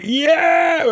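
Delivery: high, long mock-excited shout; grinning Rasta. Dan Aykroyd grinning for a photo, high mock cheer. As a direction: whoop it with a massive grin. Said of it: "A massive grin on his face as a Rasta".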